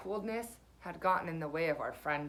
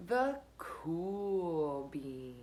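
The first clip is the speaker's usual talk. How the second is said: drawn way out, super slow and smooth; slow cool drawl; 3 semitones lower, much slower than usual. A narrator's reading. Narrator introducing the cool beans, slow cool drawl. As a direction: savour every word like it's the coolest thing ever.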